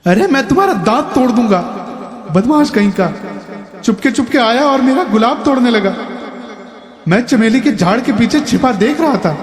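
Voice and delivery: high, fast angry threat; high quick snarl of a frail old man; teeth gritted. A narrator's reading. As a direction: spit the threat through clenched teeth. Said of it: "दांत पीस कर बोला".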